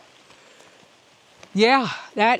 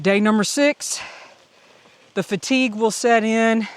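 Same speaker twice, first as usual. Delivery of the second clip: ordinary talk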